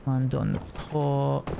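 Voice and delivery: ordinary delivery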